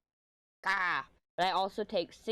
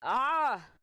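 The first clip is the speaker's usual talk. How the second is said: sharp high cry from a burning nose; pained yelp; 8 semitones higher, 6 dB louder than usual. Real, not acted. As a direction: yelp out at the sting. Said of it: "Oh, my nose is burning".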